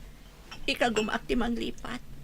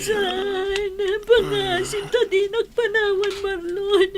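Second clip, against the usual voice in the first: tearful louder plea; crying; 6 semitones higher, 5 dB louder, slower than usual. Acted. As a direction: sob out his name as he slips away. Wife weeps over dying husband. (agsangsangit a panagpukkaw)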